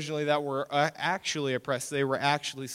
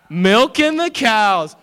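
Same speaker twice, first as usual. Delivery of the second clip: high, drawn-out loud holler; crowing it out loud and long; excited shout; 9 semitones higher, 12 dB louder, much slower than usual. Real, not acted. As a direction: belt it out slowly to the whole room. Hyped repeat of a kid's answer.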